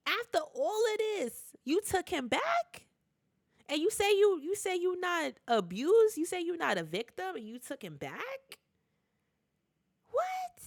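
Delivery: high-pitched, outraged disbelief at him taking the man back; incredulous disbelief. Retold or imitated. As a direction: pitch it way up, can't believe it. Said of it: "Daniel's like".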